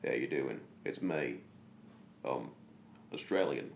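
put-on low-pitched practice run at an Australian accent; attempted Australian accent. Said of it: "Work on your Australian accent".

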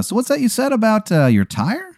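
high-pitched, inquisitive clown host; Sugar King. Acted. Clown host asking the stranded guest about a tire, high and inquisitive. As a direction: pitch it high, probing casually. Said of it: "The Sugar King says that".

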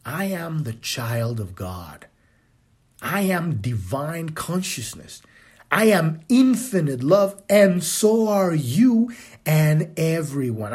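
a serene inner knowing; calm affirmation. Retold or imitated.

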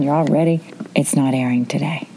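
normal talking voice

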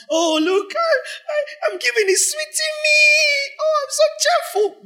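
very high, whiny mock gushing; high-pitched sarcastic whimper; mock gushing giver. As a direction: parody a giver swooning over their own generosity. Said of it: "No, that's not cheerfulness".

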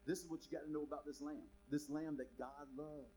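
normal talking voice

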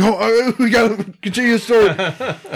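high, loud goofy voice, half laughing; mock dopey filmmakers. Host mocking the lazy filmmakers, goofy high voice. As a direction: ham up filmmakers waking from a nap.

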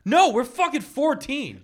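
loud, high, drawn-out mock outrage; imitated teenage indignation; indignant protest. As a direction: protest like an appalled kid, retold for laughs. Retells kids protesting to coach.

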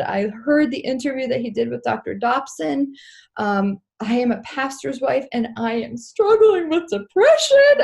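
mock sobbing, climbing into a high wail; retold crying. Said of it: "I'm crying. And I said".

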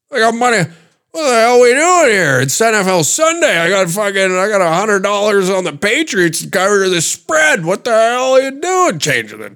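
very high, strained hollering; yelling degenerate uncle. Acted. Gambling uncle over a changed channel, mock frantic yelling. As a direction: rant in frantic, hammed-up outrage. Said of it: "degenerate uncle that's in the corner that's yelling".

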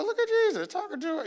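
high, softened aside; hushed mock astonishment. Disciples spot Jesus with a woman, high hushed mock astonishment.

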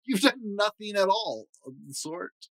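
lightly voiced chief, dismissive; chief laughing it off. Chief Ukaroo reassuring his soldier, lightly amused. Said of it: "He just laughed".

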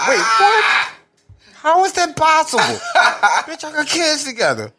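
loud, high laughing yelps of disbelief; cackling in disbelief. Real, not acted. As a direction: shriek through laughter.

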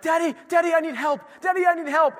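put-on high, pleading little child; high child's plea for help; whiny kid voice. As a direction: imitate a kid crying for daddy. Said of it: "They'll be like".

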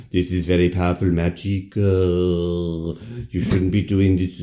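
hammed-up magic shop woman; warning shop woman. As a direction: stretch the word endings in a put-on warning. Shop woman warns the girls.

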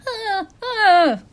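high, pained little cries from the floor; imitating naked Miranda's yelps of pain. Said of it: "who's going".

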